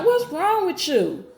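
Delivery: retold indignant retort. Acted retort to the intruding boyfriend.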